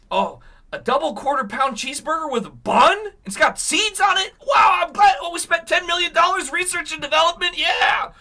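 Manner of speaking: high, loud mock wonder; sarcastic amazement. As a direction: gush over it sarcastically. Sarcastic awe at the burger.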